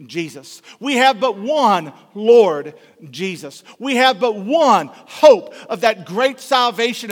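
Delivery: loud, driving sermon voice, every line pushed out to the back pews; preaching at full force. Acted. Pastor hammering his point home to the congregation, preaching at full force.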